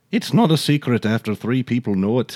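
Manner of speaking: put-on lightly lilting amateur Irish accent; playful go at an Irish accent; attempted Irish accent. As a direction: try the saying in an Irish brogue. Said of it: "That was pretty good".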